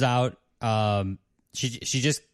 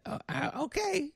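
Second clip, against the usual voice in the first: high, soft, hesitant falsetto; diner waitress; 7 dB quieter than usual. Acted. Waitress gives up on the Ghost, high soft waitress voice. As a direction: back off meekly in a high waitress voice.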